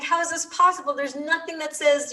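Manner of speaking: high-pitched, baffled complaint; incredulous protest. Retold or imitated. Retelling her reaction to the locked parking gates, incredulous. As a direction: protest in disbelief, pitch jumping up.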